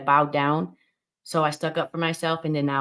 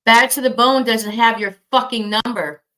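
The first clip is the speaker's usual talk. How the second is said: angry; 4 semitones higher, 8 dB louder than usual. Real, not acted. Angry denial of doxing accusation.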